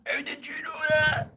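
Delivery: squeaky mock surprise. To the viewer, a high squeaky surprised question. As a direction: squeak it like a cartoon.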